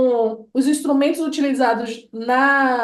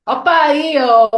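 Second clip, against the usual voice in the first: "Ô, painho" louder and slow, falling on "painho"; drawn-out Bahian call; 5 dB louder, slower than usual. Shown as a demonstration. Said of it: "nessa mesma tonalidade".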